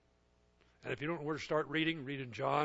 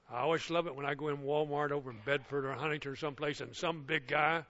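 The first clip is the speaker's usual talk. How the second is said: ordinary talk, nothing marked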